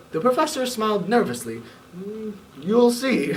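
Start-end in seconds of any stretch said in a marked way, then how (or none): 2.0-3.3 slow, knowing and nervous; hint at trouble ahead; knowing tease. Acted. Professor smiles nervously.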